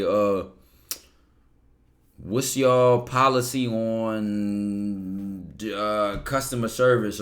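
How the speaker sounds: drawn-out, bored voice, very slow; mock bland question. Mocking a lazy interview question, slow and bored. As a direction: drag it out like a dull routine question.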